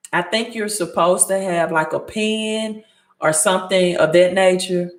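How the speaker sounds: ordinary talk, nothing marked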